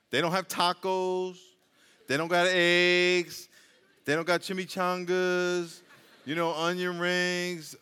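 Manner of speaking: drawn out, slow and deliberate; slow emphatic list. Acted. Pastor teasing the congregation about the menu, slow and deliberate. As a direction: spell out each missing item slowly.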